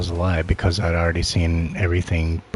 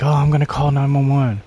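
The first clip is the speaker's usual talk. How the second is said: high, louder put-on alarm; mock surprise; 8 semitones higher, 4 dB louder than usual. Bystander act while announcing a call for help, faking surprise. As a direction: feign innocent shock, pitched well up. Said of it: "like, all surprised".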